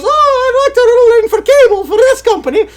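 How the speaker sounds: put-on high, fast child's voice, eager and sweet; little kid voice. Father imitating his little son calling the cable company, high and fast. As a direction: pipe up like a small boy asking nicely. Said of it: "he goes".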